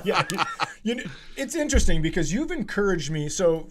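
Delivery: ordinary talk, nothing marked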